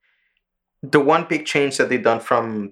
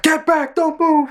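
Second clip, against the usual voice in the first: high, slightly loud mock soldier order; soldier's command; 3 dB louder, slower than usual. Retelling the show, a soldier stops the fleeing family, high. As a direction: bark it as a pitched-up soldier. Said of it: "The soldier is like".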